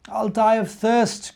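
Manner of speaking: slow, weary groan of a thirsty little bear; a mild acted groan, slowed down. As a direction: drag the words out, worn out and parched. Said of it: "groaned the little bear".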